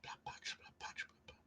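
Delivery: whispering the name to herself; soft whispered musing. Real, not acted. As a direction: breathe the words with no voice. Musing over unfamiliar place name.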